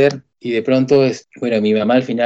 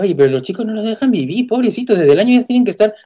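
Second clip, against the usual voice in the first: quejándose de los pobrecitos, voz más aguda; queja exagerada; 9 semitones higher than usual. Retold or imitated. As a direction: lamento indignado, agudo. Se queja de preescolar temprano. (queja indignada y aguda)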